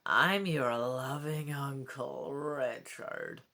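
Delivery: sinister ghost king. Acted. Ghost king voiced in story.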